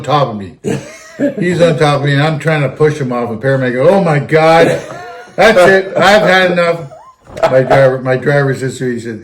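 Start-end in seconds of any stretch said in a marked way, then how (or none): 3.9-6.9 high, loud fed-up cry; exasperated outburst. Retold or imitated. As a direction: throw your hands up at it. Exasperated paramedic bursts out.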